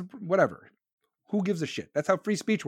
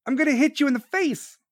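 threat; 7 semitones higher, 3 dB louder than usual. Acted. Acts out the cop's reaction.

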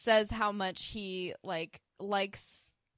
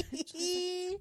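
ordinary delivery

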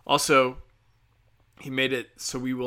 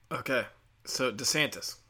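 ordinary talk

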